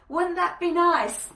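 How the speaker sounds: high, slow wishful musing; mock wishful. Someone sitting there with a goal, mock wishful. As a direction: put on a slow, high-pitched idle wish.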